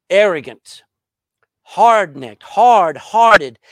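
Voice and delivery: each word hammered out loud; loud preaching. Real, not acted. Preacher thundering the charges at the congregation, loud.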